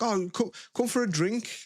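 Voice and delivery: high, coaxing mate voice; imitated high-pitched invitation; inviting friend. Acting out friend's invitation to drink.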